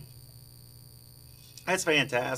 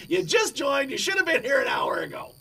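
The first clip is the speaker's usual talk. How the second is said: mock scolding; 5 semitones higher than usual. Mock scolding a late friend.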